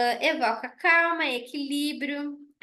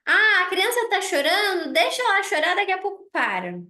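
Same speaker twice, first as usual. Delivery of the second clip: put-on raised, louder, brushing it off like an old-school grandparent; mock the old attitude of letting the child cry, a touch higher and louder; dismissive grandparents; 5 semitones higher, 4 dB louder than usual. Old-school grandparents waving off a crying child, dismissive and louder. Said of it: "daquela época de assim".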